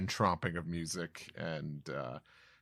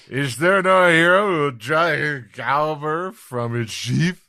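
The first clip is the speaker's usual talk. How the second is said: loud, higher theatrical call; dramatic giant; 8 semitones higher, 14 dB louder than usual. Acted. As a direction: ham up the heroic appeal.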